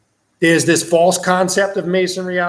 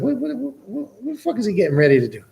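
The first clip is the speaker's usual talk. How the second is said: stunned disbelief; 7 semitones higher, faster than usual. Retold or imitated. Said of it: "I'm sitting there watching this shit like".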